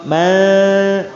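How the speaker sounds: "Manfush" diulang dengan nun samar; samarkan nun. Shown as a demonstration. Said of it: "nunnya disamarkan ya". (nun disamarkan)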